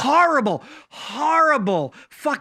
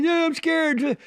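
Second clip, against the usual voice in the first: put-on higher, lightly whiny scared kid; 6 semitones higher, slower than usual. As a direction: play the frightened little boy, higher. Kid voiced in a joke.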